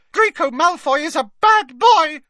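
loud, very high angry squeal; angry squeaky elf. A narrator's reading. Elf cutting in against his old master, squeaking loud and angrily. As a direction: burst out in a furious squeak. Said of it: "squeaked Dobby angrily".

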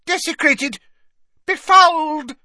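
loud, very high hissed outrage; Madame Pince. A narrator's reading. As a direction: fling each word out high and horrified. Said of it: "she hissed".

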